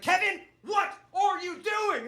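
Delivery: high, slow drawn-out mom shriek. Retold or imitated. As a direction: stretch it out like a scandalized mother. Mom repeats, furious.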